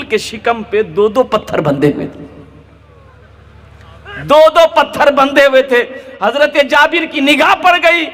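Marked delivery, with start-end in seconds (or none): 4.2-5.8 higher, louder repeat punching दो दो; stirring the congregation; raised preaching emphasis. A narrator's reading. 6.2-8.0 heightened telling. A narrator's reading. Dramatic turn in sermon.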